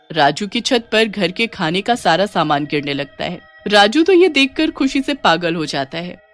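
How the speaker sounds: plain reading voice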